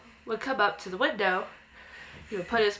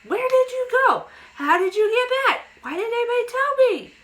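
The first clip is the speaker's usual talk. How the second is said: put-on a child overjoyed to see his dead dog at the window; excited child; 14 semitones higher, 6 dB louder than usual. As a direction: gush at the dog like a thrilled kid. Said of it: "I go".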